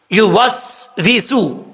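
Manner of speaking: یوسوسو spelled out very slowly, each syllable separate; slow syllable demo. Shown as a demonstration. Teacher sounds out the word for learners, slow and deliberate. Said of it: "یا کا مخرج زبان کا درمیان".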